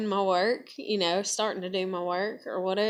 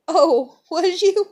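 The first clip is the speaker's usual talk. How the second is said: laughing surprise; 9 semitones higher, 9 dB louder, slower than usual. Retold or imitated. Acts her surprised reply.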